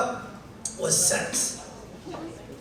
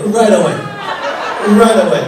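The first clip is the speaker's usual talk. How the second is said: louder repeat; 8 dB louder, faster than usual. Real, not acted. Emphatic repeat to audience.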